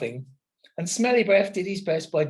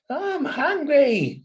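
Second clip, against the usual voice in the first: very slow, high, louder whine; whining; 11 semitones higher, 5 dB louder, much slower than usual. Acted. Smells whines again at the lake, slow high whine. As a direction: stretch the whine out like a starving pup. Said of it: "he whined".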